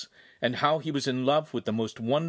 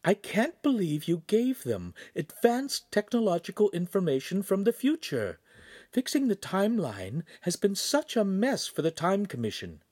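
higher female voice, exasperated; higher and fed up with the mess; Charlene's voice; 10 semitones higher than usual. A narrator's reading. Charlene chiding Pan about the timeline, higher and exasperated.

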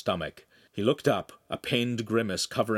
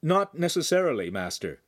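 pitched up, projected answer; repeating aloud; 6 semitones higher than usual. A narrator's reading. Handsome answers the master once more, repeating aloud. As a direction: say it out clearly for the master.

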